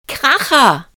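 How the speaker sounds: high, loud whoop of glee; loud, high, gleeful exclamation; delighted shout. A narrator's reading. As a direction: cry it out like a thrilled kid. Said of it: "schrie Lukas vergnügt".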